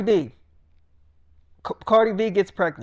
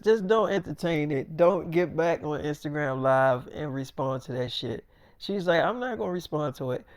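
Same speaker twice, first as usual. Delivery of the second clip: calm soft advice; 5 semitones lower, 6 dB quieter than usual. Acted. [name] calmly advising [name].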